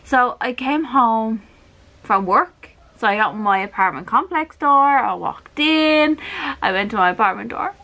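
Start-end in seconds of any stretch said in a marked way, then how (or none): none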